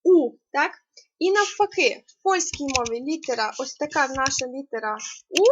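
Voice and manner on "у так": a loud, clear У; sound У. Shown as a demonstration. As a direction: say У clearly for the class. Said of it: "це є літера". (чітко вимовлене У)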